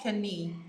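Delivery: "kani" with a sounded K before the n; K wrongly pronounced. Shown as a demonstration. Teacher showing learners the mistake, K sounded in "kani". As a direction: say "kani" in two syllables, k-uh-nee. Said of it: "We don't say kani".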